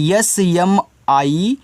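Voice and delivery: एस एम आई ई एल ई drawn out letter by letter; slow spelling. Shown as a demonstration. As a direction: spell each letter slowly. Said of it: "इसको पढ़ोगे इस्माइल". (धीरे धीरे spelling)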